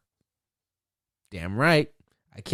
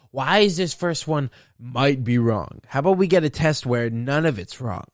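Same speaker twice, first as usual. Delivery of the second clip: ordinary talk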